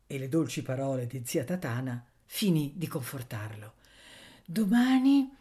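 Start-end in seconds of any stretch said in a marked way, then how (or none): 4.4-5.3 high, louder, lifted reassurance; woman's promise. A narrator's reading. Woman promising the boy tomorrow, reassuring. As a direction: say it brightly as a promise. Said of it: "diceva la donna".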